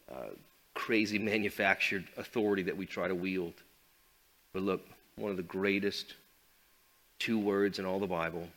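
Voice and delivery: ordinary talk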